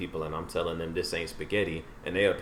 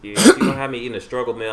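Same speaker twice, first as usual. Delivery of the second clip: mock outrage; 5 semitones higher, 10 dB louder, much faster than usual.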